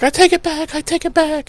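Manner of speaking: very high, small frantic voice; high, quiet mock panic; tweaking out. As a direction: imitate a girl whimpering to herself. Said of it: "tweaking out".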